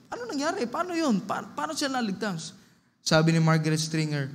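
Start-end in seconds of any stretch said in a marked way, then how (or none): 0.1-2.5 high, hushed mock amazement; baffled questioning. Friends ask Margaret how they got saved, baffled. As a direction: puzzle over it in a high voice.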